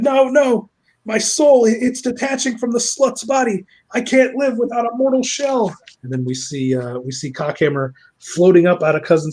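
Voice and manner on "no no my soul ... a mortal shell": mock villain's despair. Villain's soul detaching from the body, mock anguished. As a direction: cry out as if being banished, hammed up.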